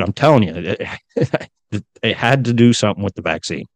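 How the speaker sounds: ordinary talk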